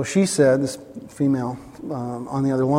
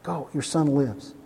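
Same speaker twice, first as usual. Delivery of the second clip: tender assurance. Retold or imitated. Jesus looking the father in the eyes, tenderly. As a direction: reassure him face to face. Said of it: "That's Jesus".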